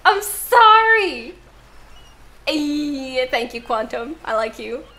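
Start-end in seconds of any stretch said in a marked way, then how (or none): 0.0-1.3 a loud, slow, drawn-out sorry; loud apology. Real, not acted. Streamer apologizing to Atty after choking, loud slow sorry. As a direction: stretch each sorry out loudly.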